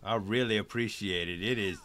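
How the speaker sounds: ordinary delivery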